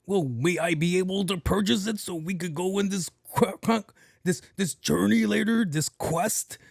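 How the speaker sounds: high, pompous questing gamer impression; elaborate role-player speech. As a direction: imitate a gamer asking as if on a heroic quest. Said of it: "this whole elaborate way of speaking".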